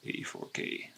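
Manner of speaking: breathy whisper of the number. Acted. Trader whispering to himself while drawing the line. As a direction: whisper it to yourself as you mark the chart.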